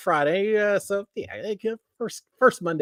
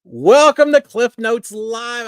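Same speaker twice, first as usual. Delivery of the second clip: slow, loud, higher show-opener; announcer intro; 7 semitones higher, 10 dB louder, much slower than usual. Real, not acted. Host opening the live show slowly. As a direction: stretch out the welcome.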